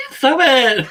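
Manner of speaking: laughing, high-pitched protest. Real, not acted. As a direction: squeal it out through laughter. Laughing while being tickled.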